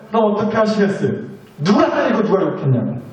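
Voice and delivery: ordinary talk, nothing marked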